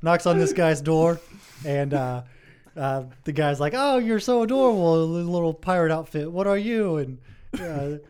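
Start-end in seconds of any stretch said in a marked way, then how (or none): 3.8-7.0 put-on high, loud cooing over a child; gushing admiration. Man at his door greets little trick-or-treater, gushing. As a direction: gush in mock delight, pitched up.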